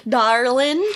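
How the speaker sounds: drawn-out fake Southern endearment; bad Southern accent. Co-host mimics the bus driver's line, bad Southern accent. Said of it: "that kind of Southern accent".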